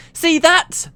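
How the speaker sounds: high, triumphant shout. Acted. Engineer gloating over her blast, shouting. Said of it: "the voice shouted again".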